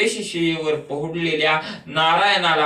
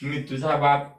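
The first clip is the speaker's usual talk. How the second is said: lower, settled answer of "मी तुझा बाप"; Vishnu's retort; 3 semitones lower than usual. Acted. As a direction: counter "मी तुझा बाप" low and firm. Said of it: "आणि ते म्हणते".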